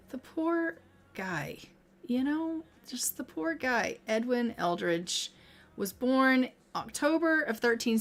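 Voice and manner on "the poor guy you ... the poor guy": soft, slow lament; quiet, slow pity for the dead son; sad murmur. Real, not acted. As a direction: sigh it out for the poor guy. Mourning the dead game son.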